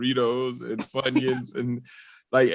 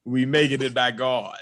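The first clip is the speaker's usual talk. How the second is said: ordinary talk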